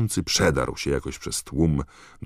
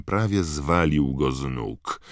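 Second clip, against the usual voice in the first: ordinary delivery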